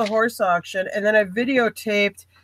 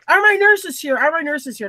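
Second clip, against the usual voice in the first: lightly voiced big hunter, pitched higher; higher-pitched mild imitation of a man calling out; Big Daddy; 6 semitones higher than usual. Hunter asking after his nurses, lightly imitated. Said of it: "I call this guy Big Daddy".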